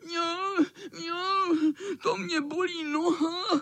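high mock-whimpering meows; whining cat. A narrator's reading. Tomcat whimpering a fake sore leg to the boys. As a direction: whine about the sore leg. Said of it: "Zakňoural".

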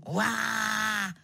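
long level "waah"; leopard roar. A narrator's reading. Leopard insisting he is not lying, mock roar. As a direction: tack a held roar onto the retort. Leopard roars after its retort.

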